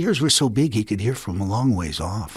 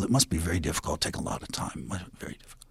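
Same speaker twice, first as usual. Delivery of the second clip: lower, softer, sinking to a whisper; sly fading murmur; 8 semitones lower, 10 dB quieter, much faster than usual. Acted. Coyote sneakily undermining Rabbit, voice fading to whisper. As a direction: fade into mock pity.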